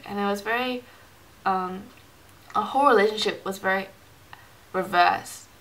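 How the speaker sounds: ordinary delivery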